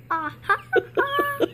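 high, loud put-on French laugh; French accent laugh. Shown as a demonstration. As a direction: do a hammy French-style laugh. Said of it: "is a French accent laugh".

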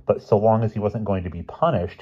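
usual speaking voice

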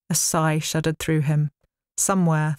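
ordinary delivery

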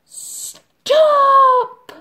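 very high, very loud bird cry; high loud shrill stop; shout. Acted. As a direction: screech it like a bossy pigeon. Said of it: "Een flew up and said".

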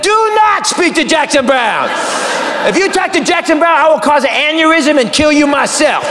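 high, hammered-up inner-voice threat; frantic warning. Acted. Inner voice warns comic off [name], frantic warning. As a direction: forbid it in a high, panicked yell. Inner voice forbids him to speak.

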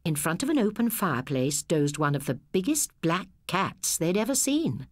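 ordinary delivery

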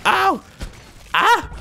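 high yelps of pretend pain; hammed-up ow and ah; mock pain yelp. Mock pain cries when hit.

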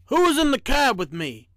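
mildly stern storybook engineer. A narrator's reading. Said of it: "Engineer Bob said sternly".

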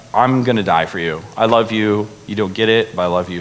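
low, gentle reassurance; gracious Jesus. Retold or imitated. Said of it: "he gives grace".

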